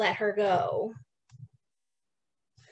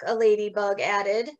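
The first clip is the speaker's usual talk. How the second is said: ordinary delivery, nothing marked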